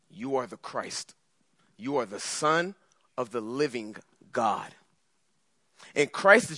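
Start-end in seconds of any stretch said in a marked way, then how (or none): 0.1-4.8 soft, low confession; reverent Peter. Acted. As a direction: Peter speaking in hushed awe. Peter confesses Jesus as Christ.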